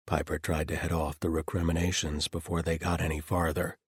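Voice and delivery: ordinary delivery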